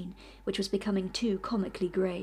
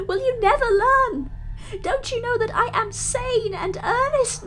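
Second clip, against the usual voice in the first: much higher and louder, begging with tears, slowing to a sob at the end; tearful desperate pleading; 11 semitones higher, 10 dB louder than usual. Acted. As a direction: beg for your soul in a torrent of tears. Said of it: "with the tears rolling down his cheeks".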